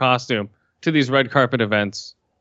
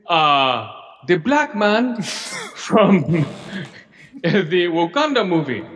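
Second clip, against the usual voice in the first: clumsy red carpet reporter; 3 semitones higher, slower than usual. Pretend. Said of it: "I'd be like".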